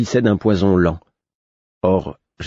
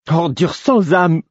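high-pitched, drawn-out furious cry; hurled insult; 11 semitones higher, slower than usual. A narrator's reading. Sister to her brother's poisoner, furious insult. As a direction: fling the insult out in outrage. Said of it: "me lança-t-elle".

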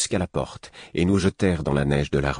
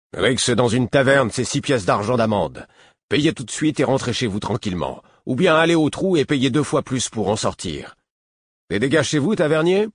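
higher, louder official pronouncing a fine; stern officer; 7 semitones higher, 3 dB louder than usual. A narrator's reading. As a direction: lay down the law to the brawlers.

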